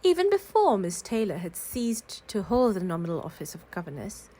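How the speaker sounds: usual speaking voice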